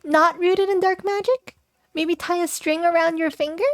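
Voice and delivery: high, worried pleading voice; alarmed friend. Acted. Character objects to dark magic.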